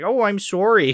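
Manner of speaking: high-pitched mock Canadian apology; Canadian accent. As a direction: put on a hammed-up Canadian accent. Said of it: "so obviously this Canadian guy".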